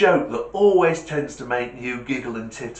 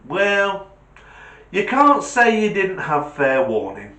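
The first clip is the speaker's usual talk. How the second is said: put-on cheeky kid; 7 semitones higher, 5 dB louder than usual. [name]'s cheeky comeback.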